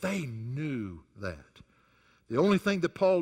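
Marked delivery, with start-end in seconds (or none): 0.0-1.6 hushed, drawn-out emphasis on they; softer, slower echo of they knew that; soft slow repeat. Acted. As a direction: let it sink in quietly.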